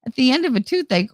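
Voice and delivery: ordinary talk, nothing marked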